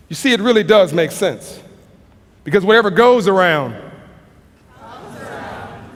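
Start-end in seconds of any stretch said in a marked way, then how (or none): 4.8-6.0 whispered ending. Real, not acted. Speaker ending his talk to the audience, whispering the last words. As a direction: breathe it out in a whisper, slowly.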